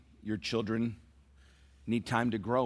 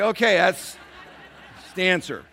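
higher, louder, laughing it off; laughing resignation; 8 semitones higher, 9 dB louder than usual. Retold or imitated. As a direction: give in with a laugh. Father gives up, laughing.

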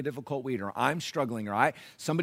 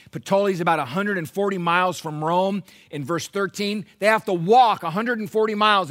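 raised, emphatic pulpit narration; full-force preaching; 7 semitones higher, 10 dB louder than usual. Real, not acted. Pastor taking the church through Paul's journey to Rome, full-force preaching. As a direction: proclaim the account to the congregation.

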